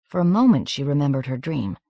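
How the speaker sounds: usual speaking voice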